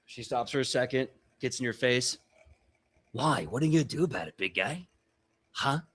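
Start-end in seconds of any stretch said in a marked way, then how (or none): 3.1-5.8 lightly acted tough challenge; confrontational orc warrior. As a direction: get in their face with a taunt. Orc gets in his face.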